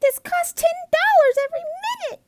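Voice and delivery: fast, very high, loud panic; yelling. A narrator's reading. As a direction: shout it out in a rush. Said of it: "my sister yelled".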